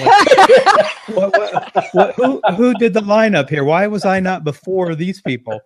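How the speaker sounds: high, loud laughing protest; laughing mock outrage. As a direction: squeal through laughter, who booked this.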